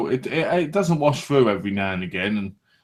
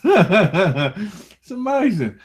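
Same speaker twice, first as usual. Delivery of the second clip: slow, high, laughing through the words; 7 semitones higher, 3 dB louder, much slower than usual. Pretend. Mocking a Nessie hunter's awe, laughing slowly. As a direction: stretch it out while cracking up. Mock awe at loch water.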